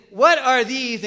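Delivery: loud, high scornful question; acted-out contempt; mocking taunt. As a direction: throw it out as a jeer.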